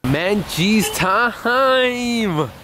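high, stretched-out sing-out; playful drawn-out cheer; excited announcement. Pretend. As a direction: draw it out like a gleeful fanfare. Announcing a frozen yogurt outing.

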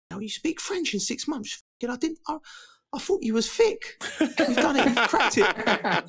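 amazed mate. Retold or imitated. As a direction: play the stunned friend who can't believe it. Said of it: "my mate's going".

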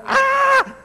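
a sudden, very high yelp of alarm; startled shriek. Retold or imitated. Preacher reenacting his shock at being asked, high shriek. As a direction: shriek as if caught off guard by the request. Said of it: "Literally, I went that".

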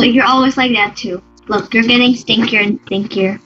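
ordinary talk, nothing marked

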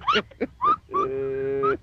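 very high squeal of laughter; a tiny high shriek of giggles. Acted. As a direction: let out a squeaky penguin laugh. Penguins laugh their flippers flopping.

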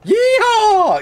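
high, loud American cowboy holler; mock American whoop. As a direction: whoop it like a hammed-up Texan. Hamming up American accent on request.

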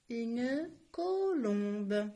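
Une colombe stretched very slowly, each syllable apart. Shown as a demonstration. Teacher to pupils, spelling out Une colombe slowly. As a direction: sound out colombe slowly for the class. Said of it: "teacher demonstrates syllables".

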